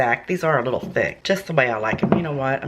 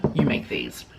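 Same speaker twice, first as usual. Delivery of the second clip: urgent whisper; 6 semitones lower, 4 dB quieter than usual. Real, not acted. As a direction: whisper "you make these" low, as a confidential tip. Urging viewers to make the recipe.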